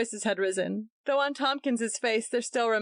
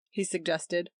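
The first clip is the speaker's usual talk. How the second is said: ordinary delivery, nothing marked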